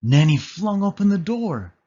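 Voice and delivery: ordinary delivery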